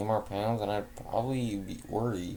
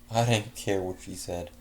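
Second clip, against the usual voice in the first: ordinary delivery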